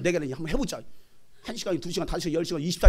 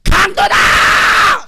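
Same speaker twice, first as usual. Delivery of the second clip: long, high, loud yell; alarm shout; 13 semitones higher, 14 dB louder, much slower than usual. Shown as a demonstration. Preacher showing how to yell at a robber, alarm shout. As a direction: scream it out to scare off a robber. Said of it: "소리 지르라니까".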